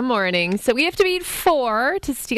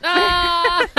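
burst of laughter; 6 semitones higher than usual. Real, not acted. Host cracks up at joke.

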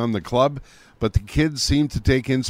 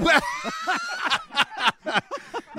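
burst of laughter; 3 dB quieter than usual. Real, not acted. Laughing at Romeo and Juliet joke.

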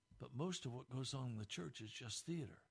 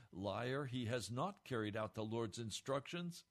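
usual speaking voice

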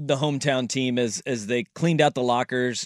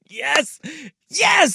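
excited laughing yes; 12 semitones higher than usual. Real, not acted. Cheering a teammate's bad shooting.

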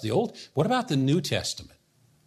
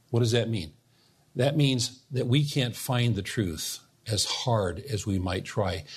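ordinary talk

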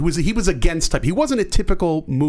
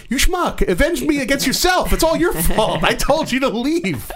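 overacted death scene; 10 semitones higher than usual. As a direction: ham up a dying friend's scolding. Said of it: "And he'd be like".

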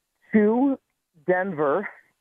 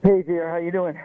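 winded after walking; out of breath; faster than usual. Real, not acted. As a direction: talk while catching your breath. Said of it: "so I'm a little out of breath".

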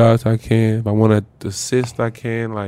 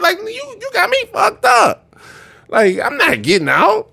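pitched-up furious refusal; high indignant outburst. Retold or imitated. Rookie refusing to leave the cab, high outraged voice. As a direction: squeal it out, outraged. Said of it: "I'm like".

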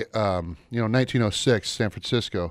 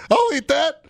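laughing; 7 dB louder than usual. Real, not acted. Laughing high quip.

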